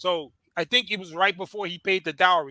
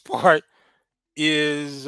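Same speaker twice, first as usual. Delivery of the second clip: ordinary delivery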